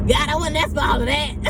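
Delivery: high, laughing protest; amused half-cry of disbelief. Real, not acted. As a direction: laugh through the words in mock overwhelm. Laughing at her own overwhelm.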